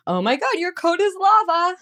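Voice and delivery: high, loud, hammed-up alarm about a lava coat; playful lava game. Shown as a demonstration. Parent turning coat time into a silly game, high and excited. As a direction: play it up like the coat is burning hot. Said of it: "if we can say".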